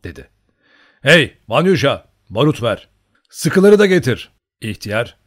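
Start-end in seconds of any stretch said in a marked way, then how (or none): 1.0-4.3 loud, commanding calls; full-voiced, brisk command; called-out orders. A narrator's reading. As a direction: shout orders to a servant. Calling orders to the servant.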